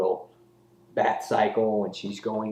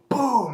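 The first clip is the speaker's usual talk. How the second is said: high, booming crash noise; 5 dB louder than usual. Retold or imitated. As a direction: burst out with a door-smashing boom. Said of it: "They barge in".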